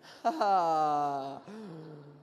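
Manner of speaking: loud burst of laughter; hearty laugh. Real, not acted. Preacher laughs at his own airline joke, loud laugh.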